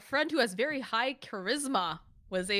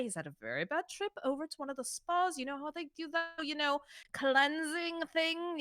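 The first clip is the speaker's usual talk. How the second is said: high, airy posh excuse; smooth over the bouncer in a fancy accent; 3 semitones higher than usual. Acted. Merchant excusing his friend to the bouncer, posh. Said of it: "in a really fancy, posh voice".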